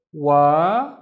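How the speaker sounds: "Wa" held long on its own; a loud, drawn-out "waa" for "Wa"; long vowel. Shown as a demonstration. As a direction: stretch the "a" in "Wa". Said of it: "making the wa twice as long".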